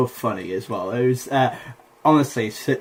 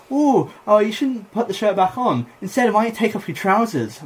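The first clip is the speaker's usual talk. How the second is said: high, teasing imitation of an audience flirt; hammed-up saucy heckler; horny MILF; 7 semitones higher than usual. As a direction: coax suggestively in a raised mock voice. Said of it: "The horny MILF was not satisfied whatsoever".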